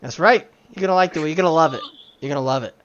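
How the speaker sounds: playful over-loud ad spokesman; mock TV pitchman. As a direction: push the sale like a commercial huckster. Riffing on a commercial pitchman.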